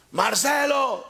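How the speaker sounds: lightly voiced mother calling high. Mother calls young Marcelo to sit and listen. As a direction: call the boy's name up high and firm.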